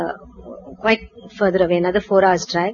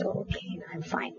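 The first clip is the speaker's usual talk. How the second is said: breathy whisper to herself, much softer; whispered inner thought; 6 semitones lower, 20 dB quieter, faster than usual. Retold or imitated. Girl late for her talk whispering to herself, whispered. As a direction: reassure yourself under your breath.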